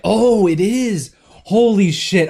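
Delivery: loud, slow, high realization; surprised. Real, not acted. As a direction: blurt it out stunned. Sudden realization.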